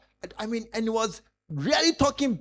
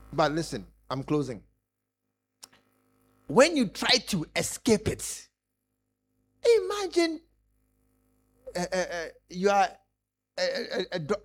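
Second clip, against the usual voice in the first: ordinary delivery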